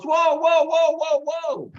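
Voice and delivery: high, loud string of whoas; interrupting professor. Retold or imitated. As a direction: cut them off with high, insistent whoas. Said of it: "the Irish professor goes".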